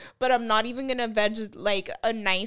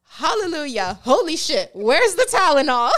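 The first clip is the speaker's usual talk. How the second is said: louder, exasperated movie dad quote; 4 semitones higher, 8 dB louder than usual. Retold or imitated. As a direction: shout it like a frazzled dad. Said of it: "the dad says".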